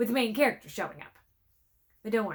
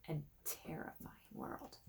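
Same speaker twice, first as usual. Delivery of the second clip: spooky whisper; 5 semitones lower, 17 dB quieter than usual. A narrator's reading. Spooky storybook narration.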